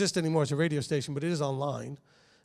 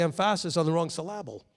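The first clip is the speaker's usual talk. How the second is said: emphasis and syllable stressed on their middle syllables; misplaced stress. Retold or imitated. Quoting his father's joke, middle-syllable stress on emphasis and syllable. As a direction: say em-PHA-sis and syl-LAB-le. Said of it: "As my father used to say".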